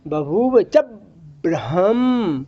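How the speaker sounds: "बभूव च", brief stop, long "Brahma"; pause before Brahma. Shown as a demonstration. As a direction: stop after "च", then draw out "Brahma" low. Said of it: "इसको कैसे पढ़ना पड़ेगा?". ("च" पर रुकें, "ब्रह्म" लंबा खींचें)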